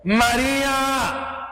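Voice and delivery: high, slow, drawn-out call of her name; Jesus calling tenderly. Acted. Jesus calls Mary by name, high, slow and tender. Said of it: "Jesús le dijo".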